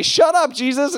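loud, high mock snap; telling Jesus to shut up, hammed-up; mock snapping. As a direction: brush him off rudely. Imagined rude retort.

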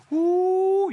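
high, loud preacher's whoop; loud excited whoop. Real, not acted. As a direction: let out a joyful whoop.

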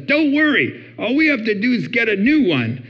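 high, breezy problem-solver; eager reassurance. Retold or imitated. Husband to upset wife, eager reassurance.

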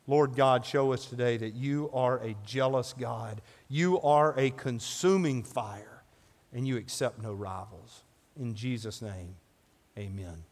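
soft, low closing prayer; quiet prayer. Acted. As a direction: pray it softly and reverently. Closing prayer after reading.

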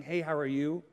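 low, soft, offhand greeting; perfunctory greeting. Retold or imitated. Someone just saying hello, not wanting the answer, perfunctory. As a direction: toss out a greeting without really asking. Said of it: "they really didn't wanna know the answer".

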